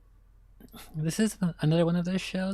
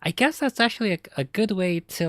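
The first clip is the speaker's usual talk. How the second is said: high and loud burst of enthusiasm; excited; 5 semitones higher, 6 dB louder than usual. Real, not acted. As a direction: jump up with delight. Excited reaction to thumbnail.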